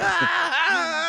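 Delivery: high, loud hammed-up kid bawling; mock crying wail. Friend mimicking the kid crying home, mock wail. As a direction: wail like a spoiled boy, played for laughs. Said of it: "You're just like".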